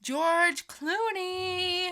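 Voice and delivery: slow, high, loud triumphant exclamation; delighted shout of the name; excited recall. Real, not acted. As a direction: stretch it out, thrilled to remember. Finally remembering the rating name.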